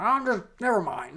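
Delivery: high, huffy neighbor voice; lightly hammed-up exasperation; mock mad. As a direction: give up in a put-on huff. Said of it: "She's, she's mad".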